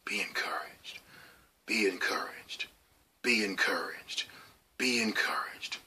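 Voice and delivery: softly whispered, fading repeats; whispered echo. Retold or imitated. Recalling Pastor Donald's encouragement in a whisper. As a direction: let the words drift back like a memory. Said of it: "I could hear Pastor Donald's voice".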